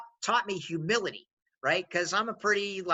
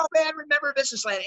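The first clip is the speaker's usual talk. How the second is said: higher, fast eager reminiscing; nostalgic excitement; 9 semitones higher, 4 dB louder, faster than usual. Retold or imitated. Old coworkers reminiscing about their company, fast and higher. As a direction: play old colleagues gushing over the past. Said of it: "And they're like".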